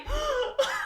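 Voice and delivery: high, loud theatrical gasp; hammed-up gasp of shock; mock horrified gasp. Woman handed to the police, mock horrified gasp. Heroine's horror at being handed over.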